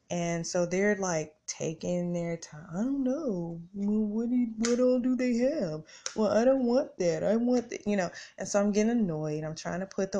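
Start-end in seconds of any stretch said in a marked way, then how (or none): 2.7-7.9 put-on pitched-up kids who can't decide; mock whiny teens. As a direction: mimic the boys dithering over what they want. Mimicking indecisive teenage sons.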